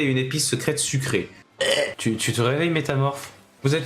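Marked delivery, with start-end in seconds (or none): none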